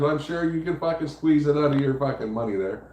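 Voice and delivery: ordinary talk, nothing marked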